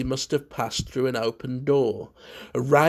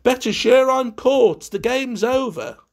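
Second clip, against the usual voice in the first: hammed-up cheerful reply; 10 semitones higher, 5 dB louder than usual. A narrator's reading. Hidden host answering game call.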